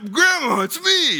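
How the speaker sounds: very high, put-on phone scammer voice; badly faked grandson, squeaky high; mock pleading in a high false voice; fake grandson voice. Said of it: "whatever his voice sounded like".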